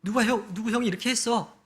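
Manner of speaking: a hint of a naive child's high voice; innocent child. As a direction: play a guileless kid telling dad on his brothers. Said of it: "그냥 순수하게 이야기했을지도 몰라요".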